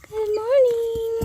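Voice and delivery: very high, slow cooing greeting. Real, not acted. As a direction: stretch it out sweetly to the animals. Cooed greeting.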